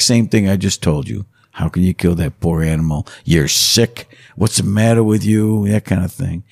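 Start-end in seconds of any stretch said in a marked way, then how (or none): none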